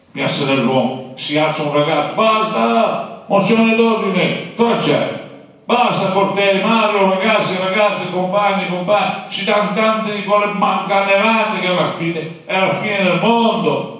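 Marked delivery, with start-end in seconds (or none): 2.1-11.9 high, urgent student cry at an assembly; shouting student. Retold or imitated. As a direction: stand up and cut the meeting short. Boy rises at student assembly. (studente che grida, acuto e concitato)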